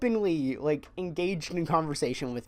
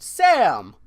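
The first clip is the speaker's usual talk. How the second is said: louder imitated holler; loud call; 3 semitones higher, 9 dB louder than usual. Buddy calling again from the back room, loud call. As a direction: shout the name as your buddy did. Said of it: "I hear a very loud".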